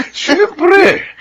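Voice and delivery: high laugh through the word. Acted. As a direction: giggle it out. Friends laughing about floor wax.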